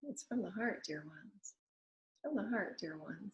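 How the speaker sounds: gentle channeled blessing; hushed channeled spirit. Pretend. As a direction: murmur tenderly to the dear ones. Channeled spirit addressing listeners.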